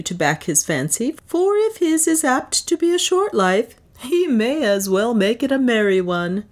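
lifted, breezy bravado; swaggering toast. Acted. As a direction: play the jovial rogue to the room. Leonard toasts the slavers.

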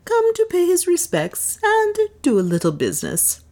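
unruffled, politely ironic; cool. A narrator's reading. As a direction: Leonard coolly introducing himself to the slavers. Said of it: "said Leonard coolly".

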